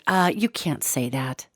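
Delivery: low, slow, soft mock censor; censor in the head. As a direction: murmur an official objection, slowly. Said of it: "I had some sensor in my head".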